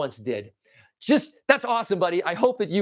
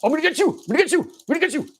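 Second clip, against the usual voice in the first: high-pitched toy villain voice; squeaky, repetitive menace from a little toy; toy fighter's taunt; 8 semitones higher, 3 dB louder, faster than usual. Acted. Purple guy going after his opponent, high taunting toy voice. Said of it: "This guy, he's like".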